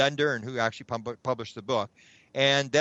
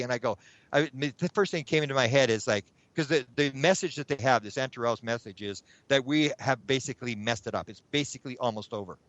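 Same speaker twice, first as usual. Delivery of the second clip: ordinary delivery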